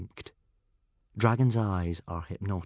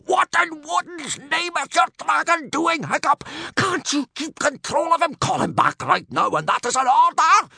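loud, high strangled stage whisper; furious strangled screech; 9 dB louder than usual. A narrator's reading. Gobber ordering Hiccup to control his dragon, furious screech. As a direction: screech furiously through a constricted throat. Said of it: "screeched Gobber in a furious, strangled whisper".

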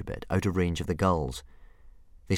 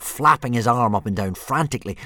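ordinary delivery

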